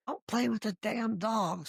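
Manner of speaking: half-hearted imitation of a scolding boss; retold yell. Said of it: "Brian would always yell at me".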